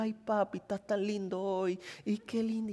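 soft, coaxing little voice; butter up dad softly; sweet-talking child. Retold or imitated. Child sweet-talking dad for a favour, soft coaxing. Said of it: "tu hijo que te diga".

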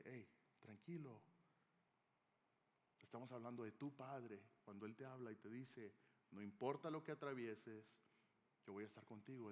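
put-on calm a worried child down; calm reassurance. The Father calming an anxious believer, soft and soothing. Preacher voices God calming the listener.

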